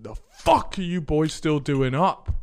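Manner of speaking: slow, drawn-out telling-off, imitating dad; stern dad. Dad catching boys up at midnight, slow mock scolding. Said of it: "Like that".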